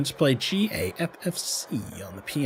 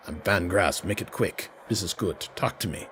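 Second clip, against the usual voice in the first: lower, quieter, curt voice of a busy fixer; intense little man; 5 semitones lower, 5 dB quieter than usual. Acted. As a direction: brush them off, no time to spare. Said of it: "He's an intense little man".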